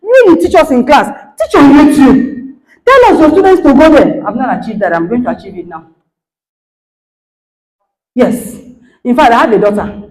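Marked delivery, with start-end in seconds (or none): 0.0-4.3 pleading student. Retold or imitated. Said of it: "And she said".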